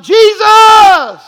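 very high, very loud scream of Jesus; yelled out. Retold or imitated. Pastor reliving the crash, screaming Jesus. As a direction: cry out in sudden terror. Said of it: "I yelled out".

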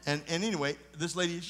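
usual speaking voice

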